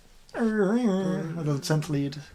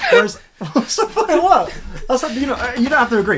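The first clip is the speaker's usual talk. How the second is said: laughing; 8 semitones higher, 6 dB louder than usual. Real, not acted. Laughing while making his point.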